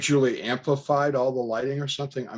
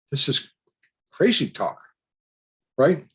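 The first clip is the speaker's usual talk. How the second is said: higher-pitched mild disbelief; incredulous, seeking agreement; disbelieving; 5 semitones higher, 4 dB louder than usual. Retold or imitated. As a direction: retell your disbelief with pitch lifted. Retold disbelief at stranger's claims.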